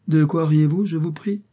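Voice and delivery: brusque. A narrator's reading. Brother confronting two laughing schoolboys, brusque. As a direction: demand an answer, high and sharp. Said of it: "il leur dit brusquement".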